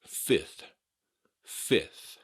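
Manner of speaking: fifth said as "fith"; silent middle F. Shown as a demonstration. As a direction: drop the second F in fifth. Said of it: "as though it's spelled F I T H".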